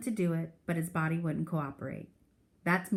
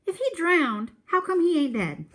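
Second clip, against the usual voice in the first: put-on higher, louder teen voice poking holes in the story; skeptical teen; 10 semitones higher, 6 dB louder than usual. Teen brother challenging the old man's story, loud and skeptical. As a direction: call out the old man's tall tale. Byron challenges the tall tale.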